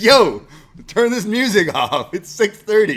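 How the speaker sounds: high, loud, half-laughing yell; retold yell at roommate. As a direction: shout him out of bed, laughing through it. Retelling yelling at roommate at dawn.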